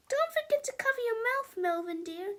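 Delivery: high sugary teacher voice; sweet teacher. A narrator's reading. Miss Ribble reminding Melvin to cover his mouth, sweetly. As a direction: coo it like a too-nice teacher. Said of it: "said Miss Ribble".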